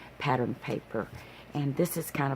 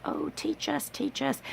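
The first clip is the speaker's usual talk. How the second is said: high, breathy begging voice; eager pleading ladies; 11 semitones higher, 4 dB quieter than usual. Retold or imitated. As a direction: beg like excited students. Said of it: "they were like".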